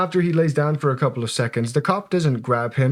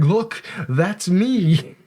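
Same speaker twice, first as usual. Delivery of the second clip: slow, drawn-out proud claim; 7 semitones higher, much slower than usual. Retold or imitated. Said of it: "him and is like".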